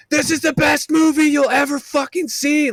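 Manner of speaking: very high, excited proclamation; re-enacted evangelizing fan's yell; rooftop yelling. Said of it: "I was yelling at every rooftop".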